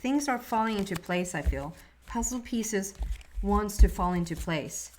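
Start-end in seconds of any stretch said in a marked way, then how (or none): none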